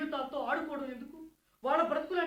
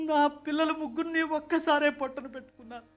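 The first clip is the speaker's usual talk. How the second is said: breaking into tears; 2 semitones higher than usual. Acted. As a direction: let the words drag out through crying. Father mourns his dead children.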